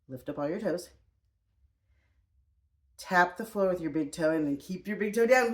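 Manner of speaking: normal talking voice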